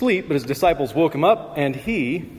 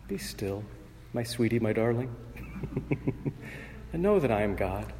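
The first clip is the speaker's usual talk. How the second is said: much softer, cooing like a lullaby with a little laugh; 7 dB quieter than usual. Shown as a demonstration. Preacher mocking the gentle reading, soft cooing lullaby. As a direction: coo it sweetly to calm a troubled soul. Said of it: "softly and sweetly, like a lullaby, cooed".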